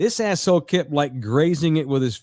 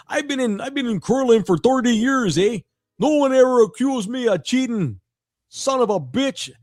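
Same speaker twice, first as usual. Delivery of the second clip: put-on higher-pitched indignant Canadian accent; 6 semitones higher than usual. Canadian curler ranting post game, mock indignant Canadian accent. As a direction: imitate an offended Canadian veteran ranting. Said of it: "He's like".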